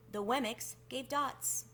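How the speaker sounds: usual speaking voice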